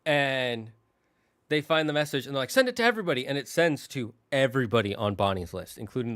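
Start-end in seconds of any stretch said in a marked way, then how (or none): 2.5-3.3 command. Retold or imitated. Toys commanding the AI.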